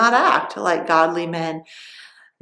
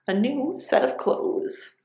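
whisper; 4 semitones higher, 5 dB quieter than usual. Acted. Samson whispers his riddle bet.

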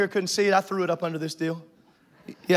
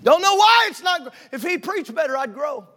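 higher griping whine; mock complaining member; 7 semitones higher, 5 dB louder than usual. Mimicking a member blaming the pastor, raised voice. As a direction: gripe about the preacher, higher.

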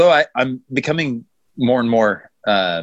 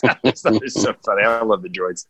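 ordinary talk, nothing marked

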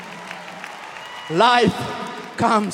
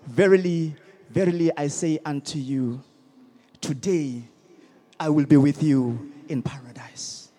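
dropping to a lower whisper by paradise; hushed Jesus voice; 11 semitones lower, 7 dB quieter than usual. Acted. Preacher voicing Jesus to the thief, hushed whisper. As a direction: breathe the promise softly, almost unvoiced.

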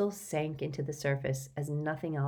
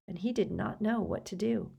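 ordinary delivery

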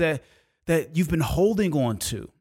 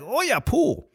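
mock-excited, pitched way up; thrilled kids; 10 semitones higher than usual. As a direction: act out kids gleeful at seeing a pool.

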